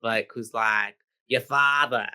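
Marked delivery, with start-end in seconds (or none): 1.3-2.1 chain-smoking woman voice. Retold or imitated. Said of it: "like a chain-smoking woman from".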